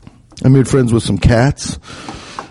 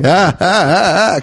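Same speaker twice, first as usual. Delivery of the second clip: slow nervous mock gasps; slow frightened ah sounds; mock alarmed; 3 semitones higher, slower than usual. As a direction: back away from the raccoons, nervously. Scared by approaching raccoons.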